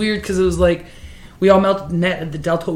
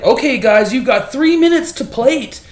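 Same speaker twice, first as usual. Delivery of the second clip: louder, higher, drawn-out time warning; contest host call; 5 semitones higher, 3 dB louder, slower than usual. Retold or imitated. Host tells contestants three minutes to plate, calling out.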